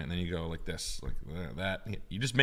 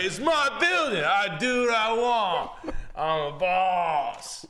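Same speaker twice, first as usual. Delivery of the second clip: much higher, louder, very slow boast; mock pompous boss; 14 semitones higher, 5 dB louder, much slower than usual. Boss asserting ownership, mock slow and pompous. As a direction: drag out each word like a puffed-up boss. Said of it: "And he's like".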